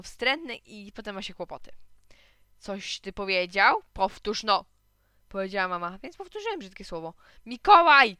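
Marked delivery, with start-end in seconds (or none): 2.6-4.6 slow, loud, menacing question; stern challenge. A narrator's reading. Mother confronts son over swearing. 7.5-8.2 very loud, high shout of the name; outraged mother yelling at her son. A narrator's reading. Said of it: "krzyknęła mama".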